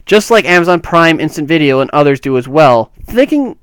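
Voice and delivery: lifted, deliberate reading voice; quote read-aloud. Acted. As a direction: read the statement out with firm emphasis. Reads a quoted statement aloud.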